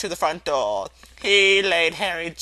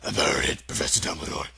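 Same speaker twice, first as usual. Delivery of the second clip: low, deep voice of a gentle giant; giant's voice; 11 semitones lower, 4 dB quieter, faster than usual. Acted. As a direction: rumble it out like a big shy giant.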